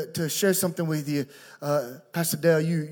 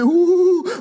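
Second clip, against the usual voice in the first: high, loud happy whoop; excited "ooh"; 11 semitones higher, 7 dB louder than usual. Shown as a demonstration. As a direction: let out a big high "ooh". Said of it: "you'll hear me say".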